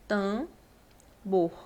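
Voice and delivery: "tambor" said slowly, the first vowel nasal; nasal vowel shown slowly. Shown as a demonstration. Teacher showing the class the nasal "tambor", slow and careful. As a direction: stretch out "tambor" so the nasal "tam" comes through. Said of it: "como se eu escrevesse dessa forma".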